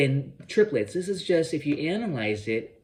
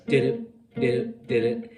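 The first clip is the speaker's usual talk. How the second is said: quick, clipped "Do-do, do-do"; sixteenth notes. Shown as a demonstration. Teacher showing the wrong sixteenth-note feel. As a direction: rattle off "do-do" in sixteenths. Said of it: "And not sixteen notes".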